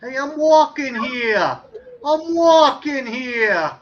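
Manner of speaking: slow, loud "walking" leaned on in a New York accent; a cabbie yelling at traffic, Boston–New York style. Shown as a demonstration. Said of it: "kinda New York and Boston kinda".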